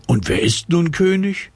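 hohe, neugierige Kinderstimme; neugierig. Acted. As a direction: frag ganz gespannt wie ein Kind. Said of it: "will Lotto neugierig wissen". (hoch und neugierig gefragt)

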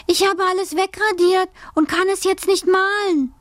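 put-on high-pitched complaining child; girl pleading. A narrator's reading. Alice confesses to her mother, high and pleading. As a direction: plead as a helpless little girl.

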